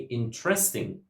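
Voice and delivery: "interesting" as in-TRES-ting; wrong middle stress. Shown as a demonstration. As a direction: hit the middle syllable of "interesting". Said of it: "would never say".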